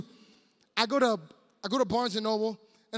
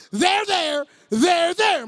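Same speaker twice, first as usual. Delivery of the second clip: loud, higher THERE, THERE; exasperated comforting; 7 semitones higher, 11 dB louder, much slower than usual. Acted. Man stuck in a long hug, exasperated comforting. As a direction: force the comfort out, much louder and higher.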